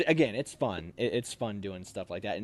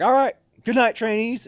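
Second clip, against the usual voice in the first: high, loud sign-off; sergeant's goodnight; 11 semitones higher, 9 dB louder than usual. Retold or imitated. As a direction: dismiss the trainees brightly. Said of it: "he'd come in and be like".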